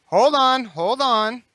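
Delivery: captain stopping the trader from stripping the ship; urgent call. Acted. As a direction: cut him off loudly. Player stops the trader.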